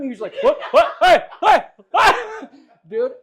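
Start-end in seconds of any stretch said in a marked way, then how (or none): 0.4-2.1 high loud startled hey hey; alarmed yell. Retold or imitated. The angry skier being pulled downhill, loud alarmed yelling. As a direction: shout like you're being dragged off.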